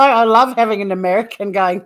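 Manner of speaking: normal talking voice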